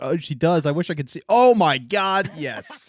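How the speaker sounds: loud, high mock ecstasy; thrilled fan. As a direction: build to a drawn-out, overwhelmed exclamation. Acts fan discovering her Twitter.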